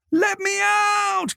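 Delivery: high, very slow wailing cry; drawn-out high wail. A narrator's reading. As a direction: wail it through the door. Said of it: "Colette wailed".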